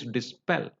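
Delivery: "dispel" stressed on -PEL; stress on last syllable. Shown as a demonstration. Teacher repeating how they said it, stress on last syllable. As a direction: hold back "dis", land on the second syllable of "dispel". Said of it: "how I said".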